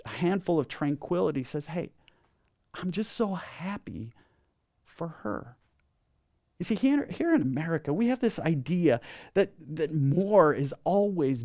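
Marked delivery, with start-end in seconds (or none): 1.7-5.5 very soft, sincere, with pauses; tranquil gladness. Acted. Tranquil person glad for a friend.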